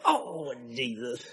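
very soft, slow, low groan of regret; hushed remorse. Retold or imitated. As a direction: sink into a quiet plea. Remorse after losing his temper.

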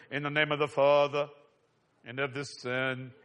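lightly mocking solemn churchy intonation; hint of a pompous bishop; imitating the bishop. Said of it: "I like the way he says".

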